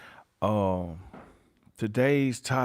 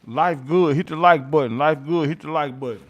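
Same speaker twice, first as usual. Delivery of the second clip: hype call; 3 semitones higher, 6 dB louder than usual. Real, not acted. Streamer hyping viewers for likes.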